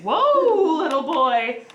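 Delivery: mock shocked parent; retold whoa. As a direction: caught off guard by a cheeky kid. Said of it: "And I was like".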